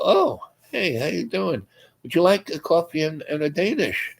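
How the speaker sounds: high-pitched, friendly offer; friendly greeting. Retold or imitated. Storyteller offers a hungry stranger coffee, high friendly greeting. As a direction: greet him lightly and invite him to share.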